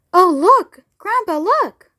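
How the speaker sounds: high, eager calls; excited cry. Acted. Charlie calling to Grandpa at the door, excited. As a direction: point it out, delighted. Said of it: "cried Charlie".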